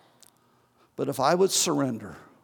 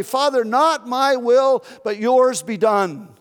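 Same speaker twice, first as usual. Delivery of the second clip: "Father, not my will" called out slow, louder and higher; fervent prayer cry; 7 semitones higher, 6 dB louder, slower than usual. Acted. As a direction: cry the prayer out slowly at full force. Preacher voices Jesus' prayer loudly.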